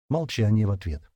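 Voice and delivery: ordinary delivery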